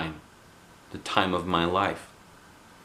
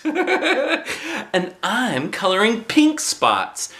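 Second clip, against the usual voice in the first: much higher, very slow laughing boast; prissy girl; 13 semitones higher, much slower than usual. Acted. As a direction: preen about her pink. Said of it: "said my bestest friend Lucille".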